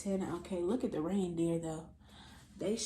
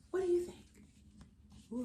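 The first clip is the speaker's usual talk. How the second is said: ordinary delivery, nothing marked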